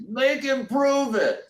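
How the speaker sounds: high, slow, loud challenge; skeptical heckler. Retold or imitated. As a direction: dare him to prove it, drawn out. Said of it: "someone else says".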